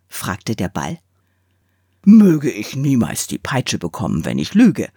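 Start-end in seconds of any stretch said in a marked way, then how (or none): 2.0-4.9 a top swearing earnestly, a bit louder; solemn oath. Acted. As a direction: swear it on your honour.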